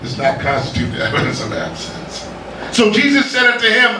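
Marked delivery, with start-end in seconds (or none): 0.0-2.2 low and soft, almost whispered; hushed maxim. Acted. Preacher hushing the refrain to the congregation. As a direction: drop it down for effect.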